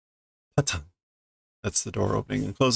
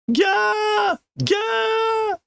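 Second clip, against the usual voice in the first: panicked screams. Acted. Girl panicking at the monster at the door, high shrieking yells.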